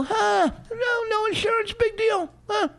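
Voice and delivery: very high mock-carefree voice; squeaky falsetto send-up of an unbothered wife; mocking nonchalance. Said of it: "Well, you act like it".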